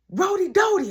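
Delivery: high calling mom voice. Retold or imitated. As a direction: call his name up the stairs.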